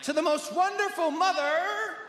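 high, slow gushing greeting-card reading; gushing flowery. Acted. Card verse read to Mother, gushing. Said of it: "in big flowery letters".

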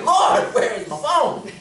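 high, loud appeal to the Lord; exasperated cry. Retold or imitated. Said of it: "I'm like".

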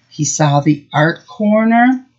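ordinary talk, nothing marked

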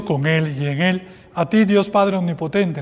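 usual speaking voice

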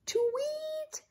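high soft chirping tweet; bird tweet. Acted. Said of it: "sang Emerson".

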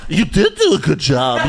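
put-on goofy sidekick voice; hammed-up fawning impression; mock Asterios. Host mimics the sidekick flattering his partner, mocking put-on voice. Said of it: "And Asterios goes".

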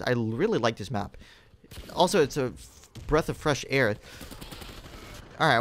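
ordinary talk

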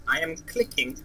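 ordinary delivery

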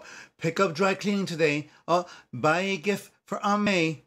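high, loud mock errand orders; mock nagging. Mimics a list of errands.

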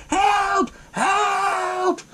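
very high mock scream for help; thin high play-acted scream; retold scream. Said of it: "putting her head up and screaming".

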